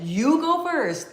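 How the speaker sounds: slow, high, sweet "you go first"; gracious, drawn-out courtesy; polite offer. Retold or imitated. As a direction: kindly let her go ahead. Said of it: "I said".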